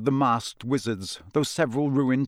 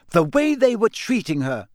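higher, louder furious outburst; 8 semitones higher, 5 dB louder than usual. A narrator's reading. Girl furious about how the elf was treated. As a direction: let the anger spill out. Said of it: "said Hermione furiously".

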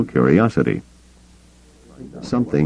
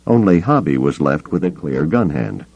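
plain reading voice

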